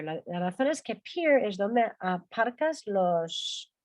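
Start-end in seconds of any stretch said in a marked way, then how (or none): none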